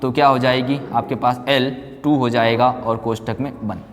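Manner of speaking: usual speaking voice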